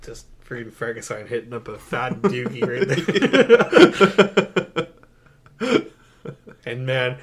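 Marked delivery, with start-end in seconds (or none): 0.0-5.0 slow, loud, breaking into laughter; cracking up. Real, not acted. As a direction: try to get it out while laughing. Cracking up over smoking monster.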